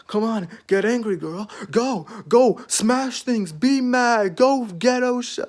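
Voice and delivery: high-pitched egging on, drawn out at the end; provoking. Retold or imitated. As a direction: goad her on in a mock pep talk. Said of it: "literally provoking her".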